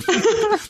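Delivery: high burst of laughter. Real, not acted. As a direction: break into giggles. Laughing at edited monologue.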